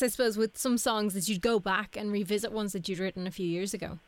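ordinary delivery

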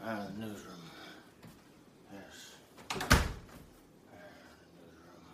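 low, rambling conspiracy newsman; Dick Hunter. Acted. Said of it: "it's time to go, Mister Dick Hunter".

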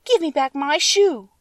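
put-on high child's voice, slow and firm; a little girl insisting angrily; angry demand. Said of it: "grew angry and said".

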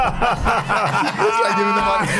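laughing through the quote, pitch way up; giggling mock quote. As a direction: crack up while repeating the line. Retelling the joke, laughing.

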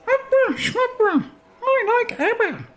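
very high, silly squeal; mock opera voice. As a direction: squeak it out as a playful put-on. Mock repeats of the word opera.

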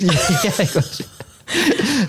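slow, laughing through words; words breaking into laughter. Real, not acted. Telling the bender story while laughing. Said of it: "laughs while telling".

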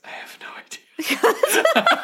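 breathy giggling whisper; laughing whisper. Real, not acted.

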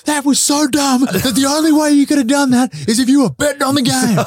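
high, loud mock coach tirade; imitating a coach's yell. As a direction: blow up at the player like an incredulous coach. Coach yelling at player.

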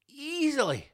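easily in a high, put-on squeak; a falsetto easily; high mock voice. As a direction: squeak easily with smug glee.

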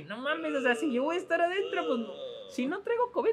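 a hint of panic about catching covid; alarmed. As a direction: squeal it like you're horrified. Retold alarm at crowded lab.

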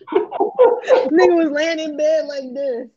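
high, loud laughing retell. Real, not acted. Retelling how he was lying in bed, laughing. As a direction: tell it slowly through laughter.

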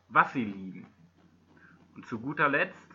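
ordinary talk, nothing marked

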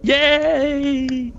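high, gleeful yay with a laugh; excited cheer. Real, not acted. Cheering at a good roll.

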